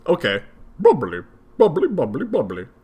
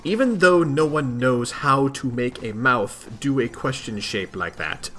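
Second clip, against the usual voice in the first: usual speaking voice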